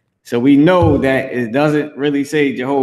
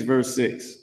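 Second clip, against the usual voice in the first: ordinary talk, nothing marked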